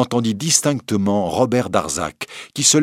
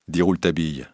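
plain reading voice

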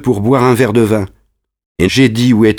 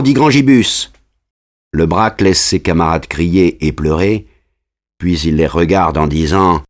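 plain reading voice

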